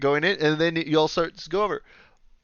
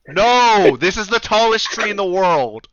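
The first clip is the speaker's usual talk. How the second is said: loud, raised-pitch protest; indignant shout; 6 semitones higher, 5 dB louder, slower than usual. Real, not acted. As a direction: contradict them loudly and emphatically. Indignant rebuttal of player's boast.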